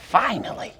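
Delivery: plain reading voice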